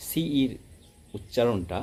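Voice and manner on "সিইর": usual speaking voice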